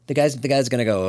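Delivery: ordinary delivery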